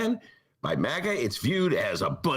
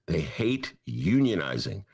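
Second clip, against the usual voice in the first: usual speaking voice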